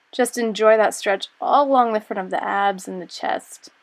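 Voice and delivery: normal talking voice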